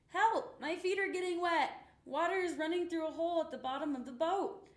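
high, loud, panicked squeak; a tiny mouse crying for help as water rushes in; squeaked in fright. A narrator's reading. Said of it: "but Horatio squeaked".

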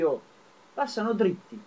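ordinary talk, nothing marked

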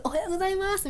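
soft, high, held-back hello; small high-pitched greeting with no force behind it; mimed greeting. Retold or imitated. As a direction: greet as if only gesturing from afar. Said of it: "みたいなモーションをするじゃないですか".

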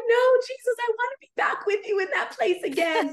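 high, loud pleading protest; distraught. Retold or imitated. Begging Jesus to bring her back, acted-out distraught. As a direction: plead in mock-dramatic distress. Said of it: "I was just so distraught".

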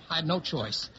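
usual speaking voice

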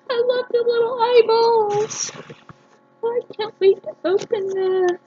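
high-pitched gushing over the gift; delighted squealing. Real, not acted. Vlogger unboxing a gift package, squealing with delight. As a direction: coo over every little thing, way up high.

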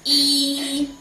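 "E" held long, high and level; level vowel demonstration. Shown as a demonstration. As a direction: sustain "E" loudly on one pitch. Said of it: "this one is".

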